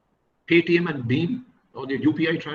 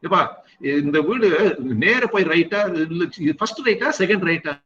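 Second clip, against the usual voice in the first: asking directions; 2 semitones higher, faster than usual. Retold or imitated. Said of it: "every ten minutes I will stop".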